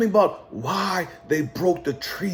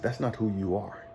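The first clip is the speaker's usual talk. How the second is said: quoted mentor; 11 dB quieter than usual. Retold or imitated. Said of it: "He said".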